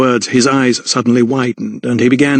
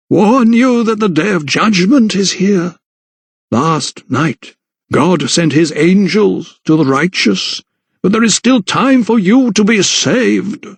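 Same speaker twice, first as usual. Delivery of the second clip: slow, high, intoned warning of doom; zealot vicar preaching; 7 semitones higher, slower than usual. A narrator's reading. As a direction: preach judgment at a sinner, drawing words out. Vicar preaches judgment at her.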